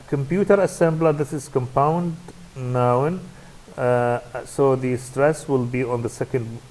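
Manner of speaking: normal talking voice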